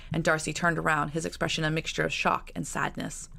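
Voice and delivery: usual speaking voice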